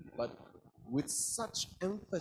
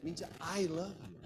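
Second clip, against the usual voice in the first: "I" lengthened and clearly accented; stress on I; 3 semitones higher than usual. Shown as a demonstration. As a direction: lean on "I", letting "love you" fall away. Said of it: "It means me, not him".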